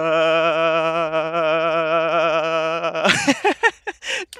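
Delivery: laughter shaken by the rig; long laugh jittering with vibration; vibrating laugh. Real, not acted. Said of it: "my voice is vibrating".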